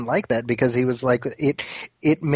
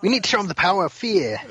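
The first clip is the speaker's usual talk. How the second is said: higher-pitched hammed-up Chicago accent; over-the-top Chicago accent; 7 semitones higher than usual. Podcaster riffing on Falcone's fear speech, exaggerated Chicago accent. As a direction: joke imitation in a mock Chicago accent. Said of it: "that over-the-top Chicago accent".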